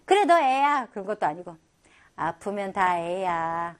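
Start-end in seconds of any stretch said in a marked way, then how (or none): 0.1-0.9 louder, teasing big-sister retort; playful older sister snapping back; teasing agreement. Retold or imitated. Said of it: "이 언니 한다는 말 보세요". 2.2-3.8 put-on slow, low, gentle older-sister voice; big sister soothing her sick little sister; tender reassurance. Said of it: "이 언니 한다는 말 보세요".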